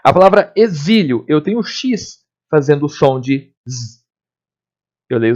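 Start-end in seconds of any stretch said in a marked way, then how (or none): none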